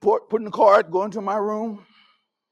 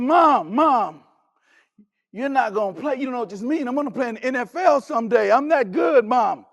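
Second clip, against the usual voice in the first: put-on louder insistent teenager; pleading protest; 3 semitones higher, 6 dB louder than usual. Son arguing loudly with his mother. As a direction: push back hard at mom.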